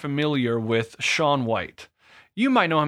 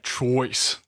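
Jersey accent. Shown as a demonstration. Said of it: "You have to say, like".

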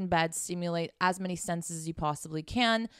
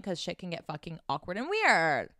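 ordinary talk, nothing marked

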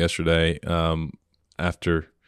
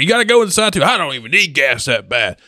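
aggravated rant; 7 semitones higher than usual. Retold or imitated. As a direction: vent like a fed-up stranger. Aggravated stranger venting.

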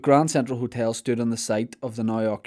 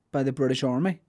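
ordinary talk

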